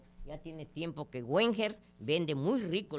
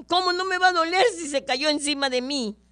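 put-on much higher, louder little-boy wail; indignant child; 9 semitones higher, 6 dB louder than usual. Crushed little boy protesting to his mother, high wail. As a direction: squeal the punchline like an outraged kid.

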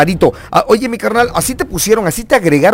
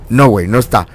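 normal talking voice

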